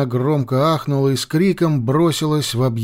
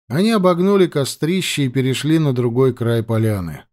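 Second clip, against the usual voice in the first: usual speaking voice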